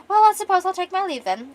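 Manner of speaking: high, loud theatrical leave-taking; loud, high, hammed-up goodbye; grand farewell. Acting a noble's showy farewell.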